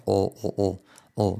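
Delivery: "O" far back in the mouth; short swallowed 'o' sounds standing for L; backed dark L. Shown as a demonstration. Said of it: "es muy atrás".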